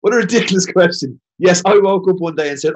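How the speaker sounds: loud, high mock outrage at a silly question; mock indignation. Answering the host's question with loud mock outrage. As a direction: play up being insulted, laughing it off.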